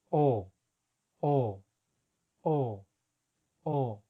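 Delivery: "O" pushed out with extra air and force. Shown as a demonstration. Teacher drilling the forceful long O. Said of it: "cuando hagamos el sonido de la O".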